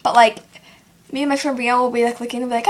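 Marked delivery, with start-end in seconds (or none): none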